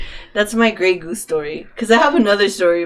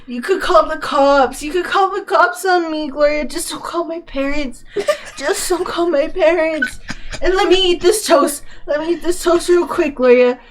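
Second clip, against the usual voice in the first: high, mock-pitiful drunk friend; drunk pleading; 6 semitones higher than usual. Drunk friend begs mom not to call her parents, high mock pleading. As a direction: plead like a drunk who's in trouble.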